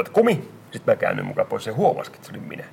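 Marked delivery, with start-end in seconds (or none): 0.1-0.5 "Kumi!" yelled far higher as a cheeky jeer; retold shout. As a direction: call out "Kumi!" like a kid taunting a teacher from behind. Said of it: "takaa huutaa". (pilkkaava huuto opettajan selän takaa)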